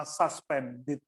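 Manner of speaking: slow SUSPENDED with first syllable as "sas"; SAS pronunciation. Shown as a demonstration. Choir coach contrasting two pronunciations, SAS version. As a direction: say SUSPENDED slowly, opening on an ah sound. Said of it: "sus dan sas itu beda".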